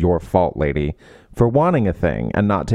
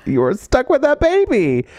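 put-on "You're stuck with that baby" pitched way up, slow; high emphatic repeat; 14 semitones higher, slower than usual. Shown as a demonstration. Friend drives home the point about the baby, high and slow. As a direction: repeat it higher and slower for emphasis.

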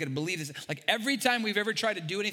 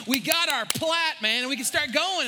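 excited builder; 9 semitones higher, 5 dB louder than usual. Retold or imitated. Builder tells pastor they got the plat, excited. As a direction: burst with the builder's joy. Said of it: "He's like".

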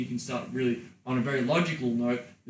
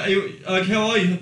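a high, louder dazed voice asking; dazed woman; 6 semitones higher, 8 dB louder than usual. Acted. Woman dazed after orgasm, loud and high. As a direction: play her spaced out and giddy. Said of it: "she's like".

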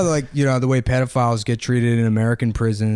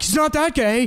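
much higher, prissy mock objection. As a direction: squeak it out like a scandalized prude. Theaters mocked, high voice.

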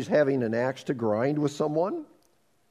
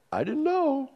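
higher, feigned innocence; mock innocent excuse; 12 semitones higher than usual. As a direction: play dumb, lightly.

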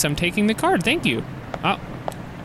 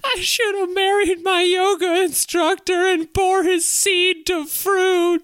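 much higher, bitter housewife voice; shrill wife voice; 13 semitones higher, slower than usual. Acted. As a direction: play the fed-up wife, voice pitched way up. Wife voice continues.

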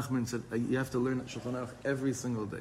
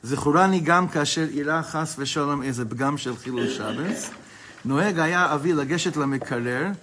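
quick, louder sing-song reading of the Hebrew; text reading; 3 semitones higher, 7 dB louder, much faster than usual. Acted. Teacher reading the book aloud to his class, fast and loud. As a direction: rattle off the printed text like a study-hall reader.

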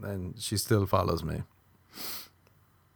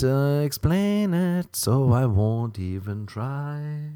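high drawn-out give-up; 5 semitones higher, 5 dB louder, much slower than usual. Real, not acted. Exasperated give-up.

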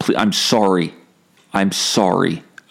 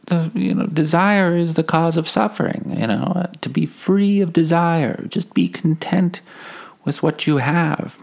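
Alan Watts; 9 semitones higher than usual. Acted. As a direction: preach contentment in a mocking high voice. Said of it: "But he's always like".

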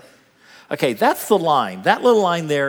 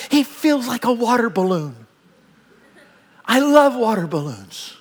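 higher, delighted exclamation; delighted grandparent; 7 semitones higher than usual. Retold or imitated. Grandparent marvels at the little grandchild, delighted. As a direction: gush over a squishy baby.

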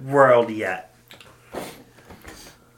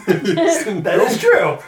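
laughing; 7 semitones higher, 5 dB louder, much slower than usual. Real, not acted. Laughing agreement at a joke.

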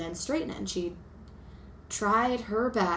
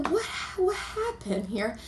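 slow, higher stunned voice; mock shock; 7 semitones higher, 3 dB quieter, slower than usual. Seeing her ruined hair at the salon, slow mock shock. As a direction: act stunned at the mirror, drawn out.

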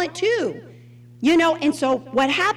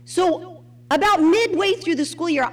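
normal talking voice

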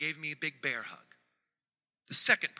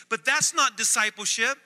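mock objection; 7 semitones higher, 10 dB louder than usual. Pastor voicing congregation's objection.

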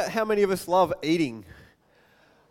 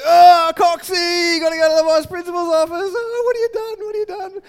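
much higher, louder mocking shout; jeering classmates; 10 semitones higher, 10 dB louder than usual. Classmates jeering at the boy called to the office, loud mocking shout. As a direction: taunt him like a whole class of teasing kids. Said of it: "everyone in the class goes".

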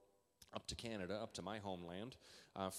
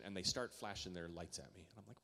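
ordinary talk, nothing marked

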